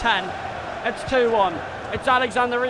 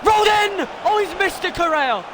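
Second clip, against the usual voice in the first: higher and louder cry of panic that fades to relief; alarmed shout; 8 semitones higher, 5 dB louder than usual. Real, not acted. Fan watching the opponent nearly score, alarmed shouting. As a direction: yelp as the shot rolls wide. Near-miss, fan's panic.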